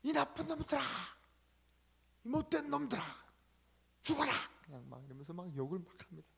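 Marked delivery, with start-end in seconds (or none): 0.0-4.3 put-on higher, shouted child's curses; boy yelling curses at his bullies from a peak; boy shouting curses. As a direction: scream insults off the mountaintop. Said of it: "큰 소리로 욕을 하기 시작합니다".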